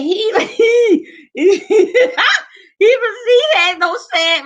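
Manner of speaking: laughing. Real, not acted. Telling the crowd about her husband on the phone, laughing. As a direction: break up laughing mid-sentence.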